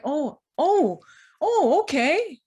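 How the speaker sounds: acted surprise, high and loud, stretched-out okay; mock surprise. Sister answering a washer offer, acting all surprised. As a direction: imitate someone acting all surprised, slowly dawning. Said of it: "acting all surprised".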